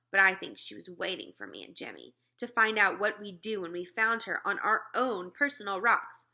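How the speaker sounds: plain reading voice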